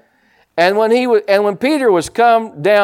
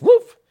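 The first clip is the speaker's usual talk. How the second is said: high sudden woof for a quick sink; a sharp whoosh of going under; a quick mock splash sound; imitated whoosh.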